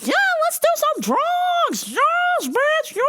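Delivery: very high, shrieking party yell; squealing party shout. Retold or imitated. The sister at the club, shrieking with excitement. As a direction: scream for the drugs like a wild party girl. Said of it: "sister hyped about drugs".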